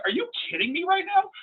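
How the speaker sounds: high, squeaky retold disbelief. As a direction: pitch it way up in mock shock. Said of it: "I'm like".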